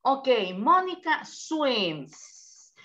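Mónica swims, said slowly with swims drawn out; stress on swims. Shown as a demonstration. Teacher correcting a student, slowly stressing swims. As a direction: lean on the verb swims slowly. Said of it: "¿Por qué le agrego ese al verbo?".

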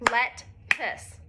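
lettuce split into two soft beats; syllable by syllable, let-tuce; soft clapped syllables. Shown as a demonstration. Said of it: "Let's clap it out".

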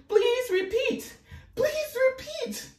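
very high, slow Siri parody; mock Siri in a high, drawn-out voice. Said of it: "talking about".

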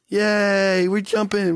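ordinary talk, nothing marked

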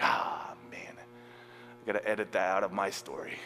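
hushed sheepish whisper to himself; a quick breathy aside. Real, not acted. Whispered aside, embarrassed about his own story.

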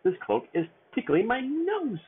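slow high squeak, nose tickled; squeaky little dwarf whining slowly. Acted. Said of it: "Sneezy squeaked".

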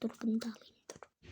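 quick whisper to herself; whispered aside. Real, not acted. Mother picking items, whispering to herself. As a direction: breathe it out as a private note. Aside while grabbing item.

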